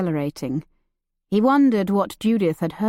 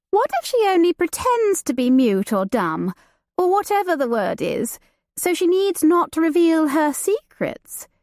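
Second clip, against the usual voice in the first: put-on higher, playful young woman's voice; light and teasing, a society lady; Judith, lively young lady; 6 semitones higher than usual. Judith teasing her cousin about the new servant, playful and high.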